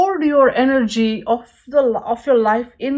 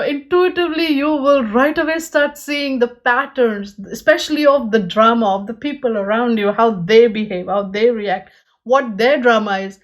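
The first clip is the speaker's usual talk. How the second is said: normal talking voice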